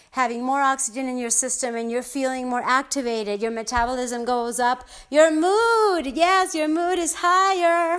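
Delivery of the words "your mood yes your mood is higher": higher, louder, upbeat; excited. Real, not acted. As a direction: lift it with real excitement. Cheering up the mood.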